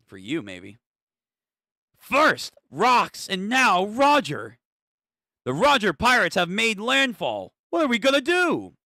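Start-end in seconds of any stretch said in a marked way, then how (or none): 2.0-8.8 high, raised voice in mock alarm; acted panic. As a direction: report disaster in a hammed-up panic. Reads panicked report aloud.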